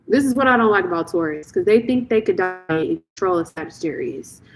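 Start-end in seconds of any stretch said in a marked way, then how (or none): none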